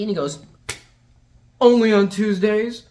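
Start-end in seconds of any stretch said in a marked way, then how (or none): 1.6-2.8 loud, slow hammed-up delivery; mock cocky comeback. D'Artagnan answering her, loud hammed-up cockiness. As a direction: boom the cheesy line out slowly. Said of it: "this horrendous line is exchanged".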